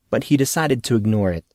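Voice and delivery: usual speaking voice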